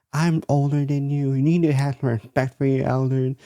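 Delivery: high-pitched mock outrage; put-on high old-lady rant; exploding old lady. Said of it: "this old lady just exploded".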